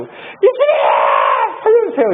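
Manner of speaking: high, loud crying plea; desperate scream. Acted. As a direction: shriek for help in panic. Disciples screaming for rescue. (겁에 질려 높게 울부짖으며)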